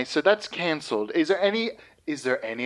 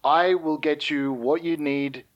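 ordinary delivery

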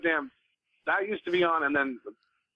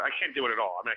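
ordinary delivery

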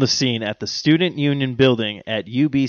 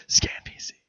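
soft stage whisper; 7 dB quieter, faster than usual. Pretend. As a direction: hush it like a secret. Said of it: "I like saying it like that".

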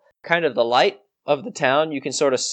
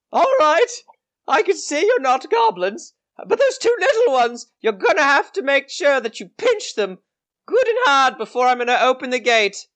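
very high-pitched character voice; old lady gatekeeper; 12 semitones higher than usual. Acted. Gatekeeper lady setting terms to open the gate, high old-lady voice. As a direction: bargain in a fluting high voice. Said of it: "this lady, is gonna say".

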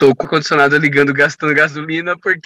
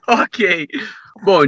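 ordinary delivery

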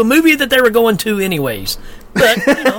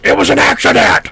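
higher, louder insistent mock camp owner; mock Mel; 7 semitones higher, 5 dB louder than usual. Camp owner Mel insisting to the cop, high put-on voice. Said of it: "Mel's like".

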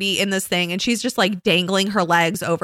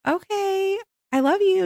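sweet mom voice; 13 semitones higher than usual. Retold or imitated. Mother telling her daughter she loves her, much higher and sweet. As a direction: float it up high and tender. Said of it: "I'm like".

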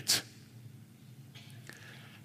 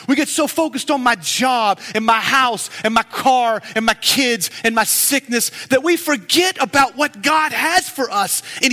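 fervent preaching; 5 semitones higher than usual. Acted. Pastor urging the church, fervent preaching. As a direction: press the congregation hard, preacher style.

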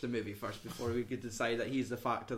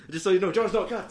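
loud, high mock announcement; retold interjection; 6 semitones higher, 8 dB louder than usual. Joking about the TV interview cut, loud and high. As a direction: call it out over the chaos. Continues acted chaotic TV moment.

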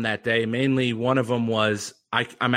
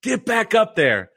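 booming yell; 9 semitones higher, 4 dB louder than usual. Retold or imitated. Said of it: "he yells it again".

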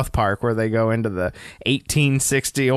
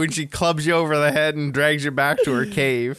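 normal talking voice

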